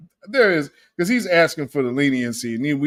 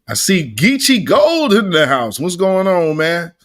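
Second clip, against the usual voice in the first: loud greeting; 3 semitones higher, 7 dB louder than usual. Real, not acted. Greeting viewer loudly.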